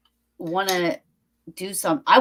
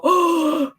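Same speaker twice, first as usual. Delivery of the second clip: hammed-up gasp then a high startled oh; mock a spooked overreaction; 11 semitones higher, 4 dB louder than usual. Mocking ghost hunters who jump at everything with a high gasp. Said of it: "we don't go".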